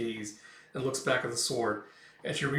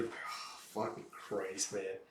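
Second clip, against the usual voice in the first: hushed disgust; 5 semitones lower, 11 dB quieter, much slower than usual. Real, not acted. Reader's shocked aside at image.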